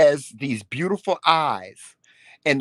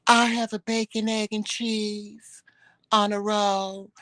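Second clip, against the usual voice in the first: higher, slow dainty order; mimicking husband; 4 semitones higher, slower than usual. Wife reenacts husband flirting at the counter, slow and dainty. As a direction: draw it out sweet like he did. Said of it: "This is how he did his head".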